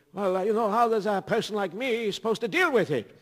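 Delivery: a hint of a fretful old man; lightly voiced old man; old man's voice. Old man complaining about noise.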